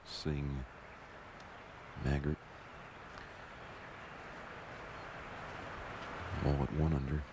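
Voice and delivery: hushed, low golf commentary; golf commentator. Real, not acted. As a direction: murmur it like a TV golf broadcaster.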